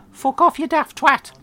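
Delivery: high, loud shrieking mum voice; Mum's screech. Retold or imitated. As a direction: screech it like an outraged old mum. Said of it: "And she replies".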